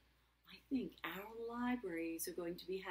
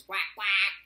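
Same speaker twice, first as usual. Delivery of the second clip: loud, lower-pitched double quack; duck quack; 3 semitones lower, 17 dB louder than usual. Pretend. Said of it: "And the duck says".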